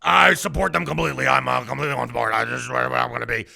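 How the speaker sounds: low, fast sneering imitation; mock Alex Jones. Host mocking Alex Jones's statement, low fast sneer. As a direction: toss it off like a spineless sellout. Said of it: "your first words are".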